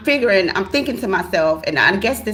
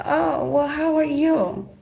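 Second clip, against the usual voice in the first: fake sweet greeting; 10 semitones higher, slower than usual. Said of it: "I said".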